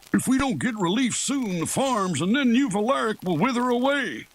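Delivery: high-pitched, worried farmer voice; distressed high-voiced appeal; pleading farmer. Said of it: "farmers came to Leoric for help".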